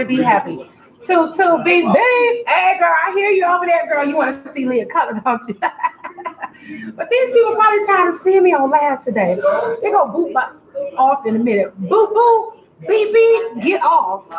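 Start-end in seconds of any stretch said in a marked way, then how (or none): 1.0-2.5 playful beeping. Acted. Playful horn noises on live. 11.8-14.2 playful beep-boop. Acted. Joking she'll be booted.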